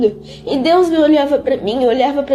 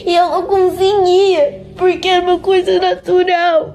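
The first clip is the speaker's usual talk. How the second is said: pitched up and breaking with real tears; crying while talking; 6 semitones higher than usual. Real, not acted. Girl telling a friend she couldn't stop crying, pitched up and crying. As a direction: fight the sob and lose.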